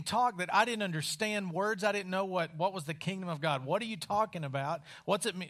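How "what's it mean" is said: usual speaking voice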